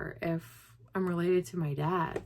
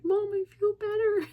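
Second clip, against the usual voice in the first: much higher, emotional relief; relieved cry; 13 semitones higher, 3 dB louder than usual. Retold or imitated. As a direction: tell Mom it finally feels better. Telling mom she feels better.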